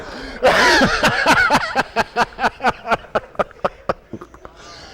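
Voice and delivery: real, long laugh that climbs high. Real, not acted. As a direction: let a big laugh ride out, high and long. Laughs at Kennedy people joke.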